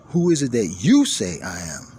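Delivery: "you" punched loud in the question; stress on you. Shown as a demonstration. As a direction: drive home the single "you". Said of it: "He said, notice".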